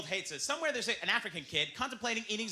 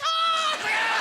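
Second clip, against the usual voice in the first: triumphant shout; 8 dB louder than usual. Acted. Triumphant exclamation in sketch.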